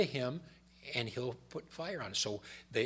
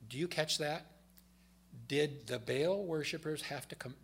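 ordinary delivery

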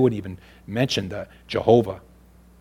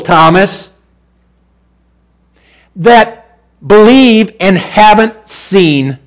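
full-force preaching; 11 semitones higher, 14 dB louder than usual. Acted. Preacher thundering scripture to the congregation, full force. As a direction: preach it out to the back pews. Preacher reading Thomas passage.

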